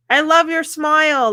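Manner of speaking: high-pitched, friendly compliment; acted cheery compliment. Driver complimenting the next person, acted cheery and high.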